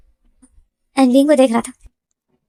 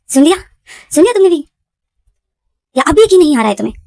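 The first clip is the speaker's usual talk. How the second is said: loud, high, furious demand; angry dad; 5 semitones higher, 6 dB louder, faster than usual. Retold or imitated. Father yells at his son's fiancé, furious and loud. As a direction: hurl the question at him in rage. Dad confronts Wing in anger.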